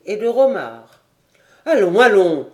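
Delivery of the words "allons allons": loud, urgent appeal; calling out. A narrator's reading. Innkeeper Kiliek steps between them, calling out loudly. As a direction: break up the fight. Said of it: "s'écria-t-il".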